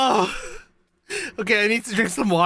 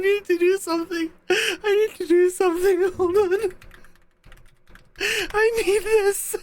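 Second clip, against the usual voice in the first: high-pitched laughter through the words; gasping to stop laughing; pleading for a second mid laughing fit; crying with laughter; 11 semitones higher than usual. Real, not acted. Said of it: "Oh, I'm actually crying".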